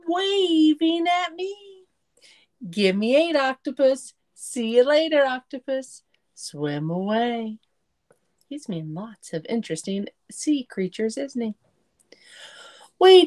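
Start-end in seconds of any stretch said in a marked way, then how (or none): none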